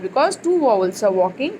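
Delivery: normal talking voice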